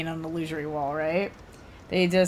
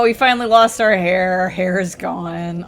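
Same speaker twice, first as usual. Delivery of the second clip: ordinary talk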